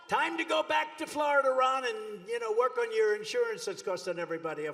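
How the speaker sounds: taunting send-off. Acted. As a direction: jeer him back home. Taunting rival at rally.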